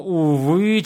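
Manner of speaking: ordinary delivery, nothing marked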